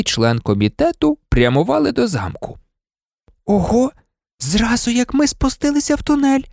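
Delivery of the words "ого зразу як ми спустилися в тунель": put-on high-pitched surprised girl's voice; exclamation. A narrator's reading. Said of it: "вигукнула Герміона". (здивований високий вигук)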